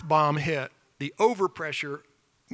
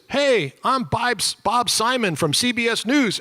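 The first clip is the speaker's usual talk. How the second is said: higher, slow, a hint of a newsman introducing himself; a captive reporter announcing himself, a bit higher and slower; lightly voiced Bob Simon; 8 semitones higher, slower than usual. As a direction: call out the name slowly from across the cells. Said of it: "somebody says".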